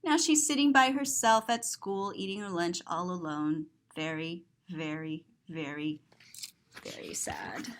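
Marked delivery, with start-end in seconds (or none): 3.9-7.7 fading sadness. A narrator's reading. Narrator stresses the girl's sadness.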